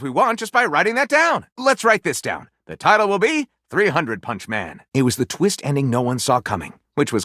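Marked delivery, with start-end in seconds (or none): none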